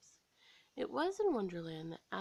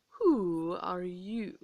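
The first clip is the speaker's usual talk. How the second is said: louder, slow haughty snap; snapped; 7 dB louder, much slower than usual. A narrator's reading. Queen of Hearts snapping at Alice, loud and slow. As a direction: bite the question out. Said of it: "snapped the Queen".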